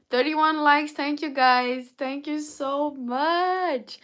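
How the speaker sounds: loud, high, slowing into drawn-out words; excited thanks. Real, not acted. Thanking viewers for reaching likes.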